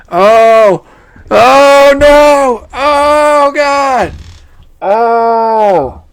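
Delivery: high, loud panicking oh no; rising yelled dismay at the screen; panicked shout. Real, not acted. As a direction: cry out in alarm. Panicking over Sayori's reaction.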